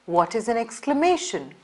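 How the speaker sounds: ordinary talk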